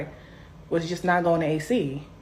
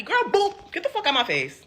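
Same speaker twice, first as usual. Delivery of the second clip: shouting; 9 semitones higher than usual. Retold or imitated. Retold fight, shouting at sister.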